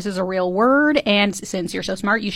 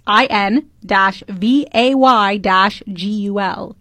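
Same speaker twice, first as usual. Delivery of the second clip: measured letter-by-letter readout; phonetic spelling; slower than usual. Shown as a demonstration. Host reads the phonetics to a doubting co-host, slow spelling. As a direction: read out each letter and dash slowly. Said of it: "The, the phonetics".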